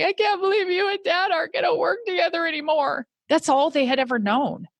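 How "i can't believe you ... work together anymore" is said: put-on high, teary child's plea, voice breaking; imitating a crying child. Young son on mom's lap, crying. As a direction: echo a sobbing little boy's dismay. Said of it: "My youngest son crying on my lap going".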